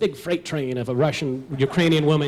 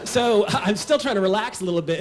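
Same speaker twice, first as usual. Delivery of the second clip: ordinary delivery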